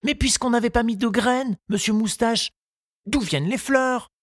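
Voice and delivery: lightly voiced young boy, pitched high and eager; a boy's light high voice, keen to finish; Tistou's voice. Tistou reassuring his gardening teacher, in a high boyish voice.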